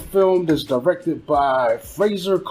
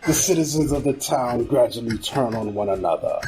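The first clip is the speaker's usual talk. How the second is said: creepy narrator; 4 semitones lower than usual. Acted. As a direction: read it low and ominous, stretching the line endings. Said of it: "working on my creepy narrator voice".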